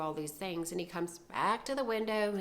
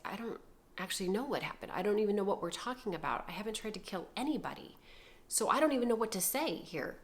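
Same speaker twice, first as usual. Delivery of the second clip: rapid, rambling protest; flustered denial; much faster than usual. Retold or imitated. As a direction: rush through it in bewildered self-defense.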